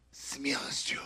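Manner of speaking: sudden drop to a whisper; hushed blessing. Real, not acted. Preacher blessing Russian-speaking believers, hushed whisper. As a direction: breathe it out very softly.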